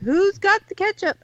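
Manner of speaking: high-pitched waitress calling out; hollered call. Acted. Waitress hunting the ketchup bottles, calling out high. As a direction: call it high across the dining room. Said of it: "Then they holler".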